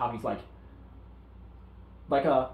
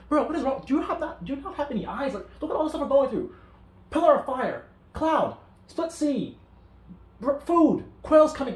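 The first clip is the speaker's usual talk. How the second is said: exasperated rant; 11 semitones higher, 4 dB louder than usual. Retold or imitated. Moses exasperated at complaining people.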